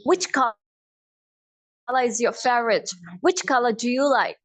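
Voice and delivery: slow British accent, "color" ending in "ah". Shown as a demonstration. Teacher converts color question, British accent. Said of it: "British accent में convert करूँ".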